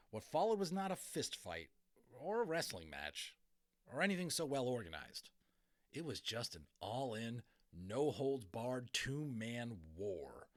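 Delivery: plain reading voice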